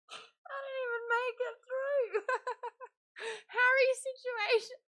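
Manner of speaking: high squeal through helpless laughter; cracking up. Real, not acted. Reading own joke drag names to viewers, cracking up. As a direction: barely get the words out for laughing.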